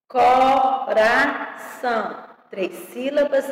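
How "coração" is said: slow "Coração", co-ra-ção in separate beats; syllable by syllable. Shown as a demonstration. Teacher separating words for pupils, syllable by syllable. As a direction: count out each syllable of "Coração". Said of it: "separação de palavras e sílabas".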